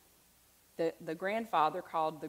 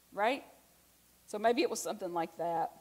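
normal talking voice

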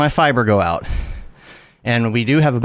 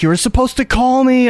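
angry; 11 semitones higher than usual. Retold or imitated. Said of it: "I'm like".

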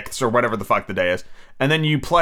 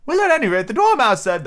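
high, fretful Hatter; nervous Hatter voice; 10 semitones higher, 4 dB louder than usual. Acted. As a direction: plead it high and thin.

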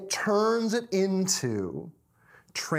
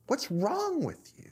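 high, strained, half-whispered disbelief; exasperated snapping; 10 semitones higher, 4 dB quieter than usual. Acted. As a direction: squeeze it out high in mock exasperation. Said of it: "snaps at Jim and barks across".

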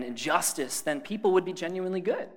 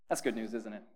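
soft dry mock approval; deadpan, quiet irony; sarcastic; 5 semitones lower, 7 dB quieter than usual. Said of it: "I'm being sarcastic".

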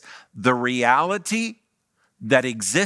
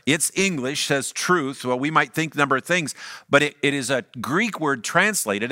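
usual speaking voice

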